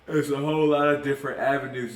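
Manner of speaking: talking through a yawn; stretched mid-yawn words. Real, not acted. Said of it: "Excuse me, yawn".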